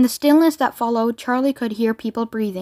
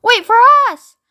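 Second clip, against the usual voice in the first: high, loud desperate call; urgent yell from behind; shouting; 10 semitones higher, 7 dB louder than usual. A narrator's reading. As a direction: shout after people who are leaving.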